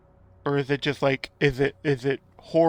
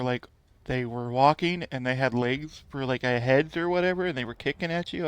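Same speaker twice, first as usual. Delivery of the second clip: usual speaking voice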